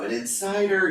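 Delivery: high-pitched mock amazement; astonished onlookers. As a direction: voice the baffled crowd, pitched well up. Acting out amazed onlookers' guesses.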